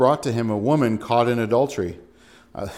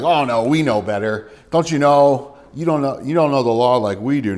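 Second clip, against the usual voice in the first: mock-prideful Pharisee, a little louder; smug retort, lightly acted; 2 semitones higher, 7 dB louder than usual. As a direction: play the know-it-all scoffing at Nicodemus. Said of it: "it's this prideful thing".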